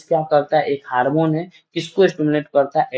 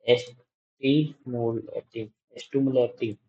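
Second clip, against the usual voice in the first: slow; 2 semitones lower, 6 dB quieter, much slower than usual. Shown as a demonstration. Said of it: "tropic मतलब क्या होता है?".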